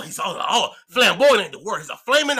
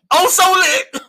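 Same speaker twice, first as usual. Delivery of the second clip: excited shout; 12 semitones higher, 9 dB louder, slower than usual. Real, not acted. Brand shout while high.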